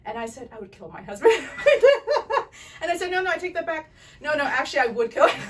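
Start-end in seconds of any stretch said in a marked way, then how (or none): 0.5-1.3 laughing it out, much louder; doctor joking to the patient, laughing. Real, not acted. Joke told with laughter. 1.4-2.8 loud, high burst of laughter; doctor bursting out laughing at the joke. Real, not acted. Big laugh after joke. 2.8-5.4 laughing, louder and higher; doctor taking back the joke, laughing. Real, not acted. Joking correction while laughing.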